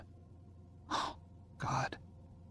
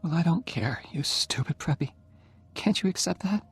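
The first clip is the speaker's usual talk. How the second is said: higher young woman's voice, mock scolding; dying girl scolds Oliver playfully, higher voice; Jenny's voice, teasing; 8 semitones higher than usual. A narrator's reading. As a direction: tease him, higher and pointed.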